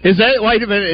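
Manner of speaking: ordinary talk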